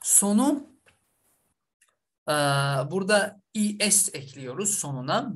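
ordinary delivery

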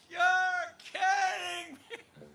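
high, stretched-out, laughing disbelief; amazed disbelief. Retold or imitated. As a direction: Lonnie hearing he missed Dylan, laughing amazed disbelief. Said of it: "And Lonnie was like".

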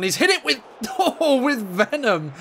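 excited laugh at a venomous shot; laughing call. Real, not acted. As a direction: laugh while calling the strike.

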